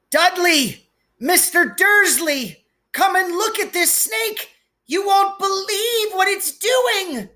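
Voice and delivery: put-on a boy yelling high across the reptile house; excited shout. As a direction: call out to Dudley, thrilled. Piers shouting for Dudley.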